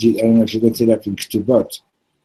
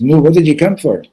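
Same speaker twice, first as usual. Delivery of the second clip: higher, louder pressing question; impatient demand; 5 semitones higher, 5 dB louder than usual. Acted. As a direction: finally come out and demand an answer. Maggid finally demands an answer.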